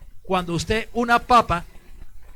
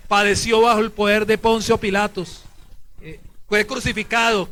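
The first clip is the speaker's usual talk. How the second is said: loud, declaimed for repeating; creed recitation; 3 semitones higher, 6 dB louder than usual. Real, not acted. Priest leading the short creed, loud recitation. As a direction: lead the short creed firmly.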